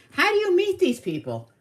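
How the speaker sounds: very high-pitched, mock-baffled question; retold incredulity. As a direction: shoot the pitch way up in disbelief.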